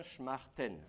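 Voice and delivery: "shmarten" with sh straight into m and a final n; feminine shmarten. Shown as a demonstration. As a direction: say "shmarten" with no vowel after sh. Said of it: "pareil au féminin". (« shmarten » sans voyelle après ch, n final)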